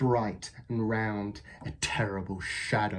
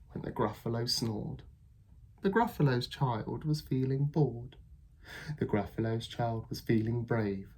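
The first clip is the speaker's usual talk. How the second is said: usual speaking voice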